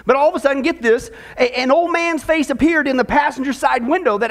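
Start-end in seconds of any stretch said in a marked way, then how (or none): none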